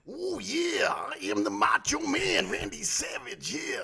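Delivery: big drawn-out wrestler catchphrase voice; Macho Man Randy Savage. Acted. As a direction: swoop up high, then growl it out slowly. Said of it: "I'm gonna go against you with Macho Man".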